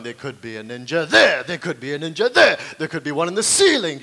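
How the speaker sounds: hammed-up frantic ninja spotting; gone mental. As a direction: hit the end of each sighting loudly, as if losing it. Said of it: "I went a bit mental".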